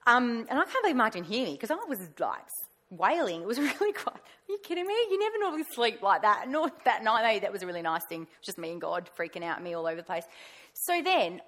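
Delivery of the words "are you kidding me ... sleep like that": higher, laughing disbelief; mock disbelief. Marvelling that her husband slept through her wailing, higher mock disbelief. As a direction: tease him for sleeping through it all.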